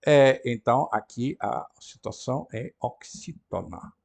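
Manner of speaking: usual speaking voice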